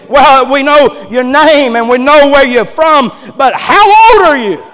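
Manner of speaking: high, loud questioning that peaks at the end; loud demanding question. Acted. Doctors asking the boy his age, loudly demanding. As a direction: press the question at full preaching volume.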